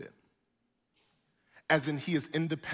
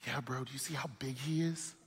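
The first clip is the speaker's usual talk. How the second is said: hint of frightened soldiers; mock scared soldiers; 7 dB quieter than usual. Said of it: "And they're like".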